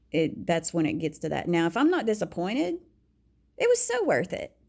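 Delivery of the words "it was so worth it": delighted. Real, not acted. Telling how good it is when satisfied, excited gush. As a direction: pitch leaps way up, gushing. Contrast: not disappointed, delighted.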